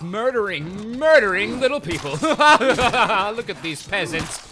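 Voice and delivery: slow, loud, laughing sneer; laughing gloat. Pretend. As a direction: laugh down at the peasants. Gloating over game enemies.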